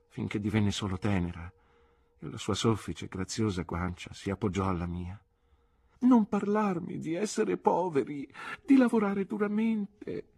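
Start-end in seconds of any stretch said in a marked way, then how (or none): 6.0-10.3 high and slow, clinging plea; Dora pleading. A narrator's reading. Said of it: "disse Dora stringendosi di più a me". (supplica lenta con voce alta da ragazza)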